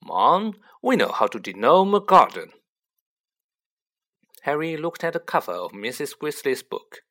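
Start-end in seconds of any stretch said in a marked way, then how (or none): 0.0-2.5 loud, groaning protest; groaned. A narrator's reading. Son groaning to his mother about gnome instructions. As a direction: complain in a groan, voice raised. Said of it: "George groaned".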